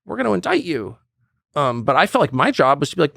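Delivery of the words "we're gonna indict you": high, sneering taunt at Trump; mocking critics. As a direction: mimic the jeering crowd telling him to quit. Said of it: "Everyone else is like".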